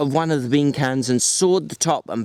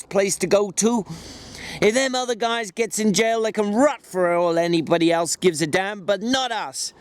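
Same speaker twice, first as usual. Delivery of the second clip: George voice; 7 semitones higher than usual. A narrator's reading. George recites dream, raised voice.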